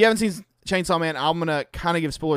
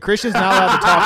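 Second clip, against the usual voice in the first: laughing quote; 4 semitones higher, 7 dB louder, faster than usual. Retold or imitated. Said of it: "he said".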